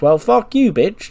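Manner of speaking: high, loud mock outburst at Indy; angry retort. [name] snaps at Indy.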